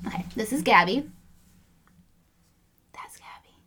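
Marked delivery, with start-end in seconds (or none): 2.9-3.5 breathy whisper, barely audible; whispered demo. Shown as a demonstration. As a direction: whisper the name to show the cheeks. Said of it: "I'm laughing".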